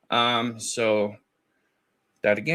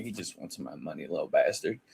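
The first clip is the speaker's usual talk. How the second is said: muttered aside; 6 semitones lower, 7 dB quieter than usual. Retold or imitated. Muttered retold reply.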